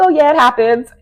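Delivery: high, loud, breezy brush-off; dismissive Kyle. Retold or imitated. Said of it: "She was like".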